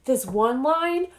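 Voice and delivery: slow, drawn-out dawning surprise with a gasp; mock realization. Author imitating a rereading fan, slow mock gasp of realization. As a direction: act out a reader catching the clue, slowly. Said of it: "you're like".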